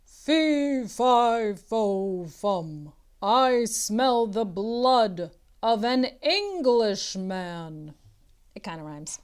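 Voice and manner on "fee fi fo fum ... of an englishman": giant booming loudly, rising menace; louder giant boom. Acted. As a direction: boom it out even bigger, like a giant hunting. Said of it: "The voice got louder".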